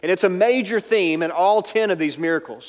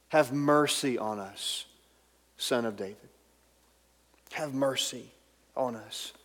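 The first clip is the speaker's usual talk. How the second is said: soft pleading; 5 semitones lower, 9 dB quieter than usual. Acted. Preacher voices blind men's plea.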